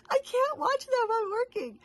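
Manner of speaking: very high plaintive wail; mock distressed whine. Busy viewer missing new videos, mock distress. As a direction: protest helplessly in a high voice. Acts out her frustrated reaction.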